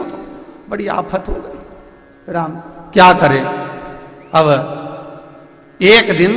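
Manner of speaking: ordinary delivery, nothing marked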